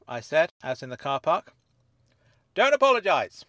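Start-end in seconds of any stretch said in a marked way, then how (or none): 2.5-3.4 high, loud and emphatic; pleased Dennis. Acted. Dennis to the narrator in the car park, pleased and loud. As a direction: wave the apology away loudly. Dennis delighted after the meeting.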